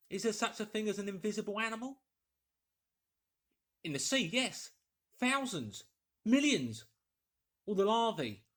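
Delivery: a scientist talking himself into a wild idea; Kemp puzzling aloud. Acted. Kemp voiced arguing with himself.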